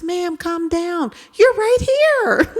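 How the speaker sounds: high, slow mock-soothing voice; calm her down like a child; amused reassuring man. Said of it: "He goes".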